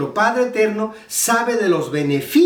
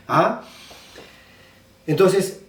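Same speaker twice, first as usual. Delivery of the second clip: ordinary delivery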